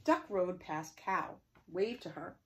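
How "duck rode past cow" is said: usual speaking voice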